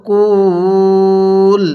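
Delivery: কুল stretched very long and level; long held vowel. Shown as a demonstration. Teacher showing the stop at the verse end, long vowel. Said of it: "এরকমভাবে শিখব".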